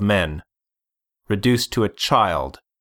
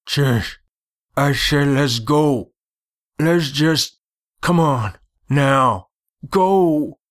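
higher, rising urgency in short bursts; urgent pleading; 6 semitones higher, slower than usual. A narrator's reading. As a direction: hurry him away, pitch climbing to the last word. Companion urges Chess to leave.

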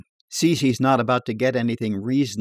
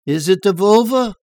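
higher, louder questioning barfly; raised voice, abruptly uncertain; suddenly unsure; 8 semitones higher, 8 dB louder than usual. A narrator's reading. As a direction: blurt the question, thrown into doubt. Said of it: "suddenly unsure".

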